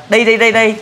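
a hint of an excited kid; eager child. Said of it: "Hay là".

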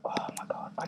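hushed breathy whisper. Real, not acted. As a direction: whisper it under your breath. Whispering while playing.

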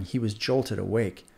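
ordinary delivery, nothing marked

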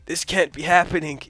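a hint of a doomed action hero; mock dramatic hero. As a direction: play a dying movie hero, lightly. Mock dramatic death lines in game.

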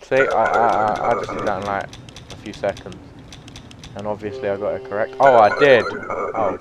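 ordinary delivery

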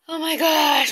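high, loud oh my gosh; overwhelmed outburst. Real, not acted. Vlogger overcome before sharing the clip, loud and high. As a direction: burst out, caught by feeling.

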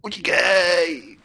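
high, slow shouted command. Real, not acted. Player yelling at his character to run away. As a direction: yell to run for it. Telling himself to flee.

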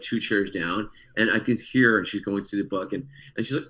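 ordinary talk, nothing marked

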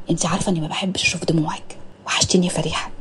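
tender unvoiced whisper in her ear.

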